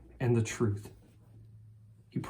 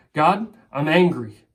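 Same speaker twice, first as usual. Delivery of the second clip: loud, slow, heated confession; angry example prayer; 3 semitones higher, 9 dB louder than usual. Pretend. Believer confessing anger to God, loud and heated. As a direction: say it to God with real heat. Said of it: "For you to say to God".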